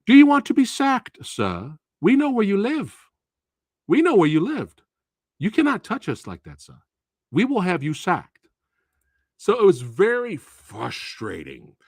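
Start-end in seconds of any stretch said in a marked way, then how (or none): 0.1-8.5 threatening polite students. Acted. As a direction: warn the teacher sweetly but firmly. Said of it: "they were like".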